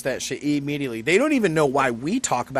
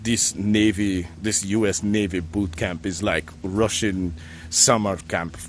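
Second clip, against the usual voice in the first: put-on slow, low Russian accent; 7 semitones lower than usual. Imitating a Russian recruit in boot camp, slow low Russian accent. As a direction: drag it out in a heavy Russian accent. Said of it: "he was a Russian kid".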